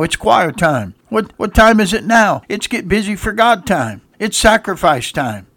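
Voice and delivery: loud, high call-and-response preaching; preaching at full force. Acted. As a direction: drive it home to the congregation.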